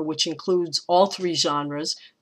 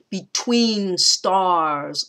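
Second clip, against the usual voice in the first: "Between stars" very slow, both stressed; spondee; much slower than usual. Shown as a demonstration. Teacher demonstrating a spondee to her class. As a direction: stretch out "stars" with full weight. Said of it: "I think that's a spondee too".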